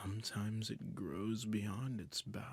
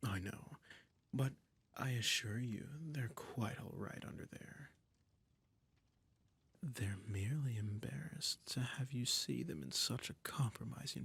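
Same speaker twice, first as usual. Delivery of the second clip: doting emperor. Acted. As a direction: purr control over a frightened pet. Holds a doting, domineering emperor role.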